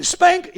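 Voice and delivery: high shouted Spank; comic impact yell. Retold or imitated. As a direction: shout the smack like a sound effect.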